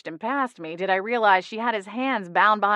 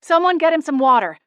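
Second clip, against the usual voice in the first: urgent; 8 semitones higher, 7 dB louder than usual. A narrator's reading. Holly fakes urgent concern.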